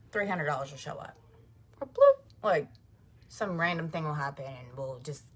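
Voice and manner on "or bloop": very high loud "bloop" pop; bloop sound. Pretend. Money suddenly appearing, high cartoon bloop.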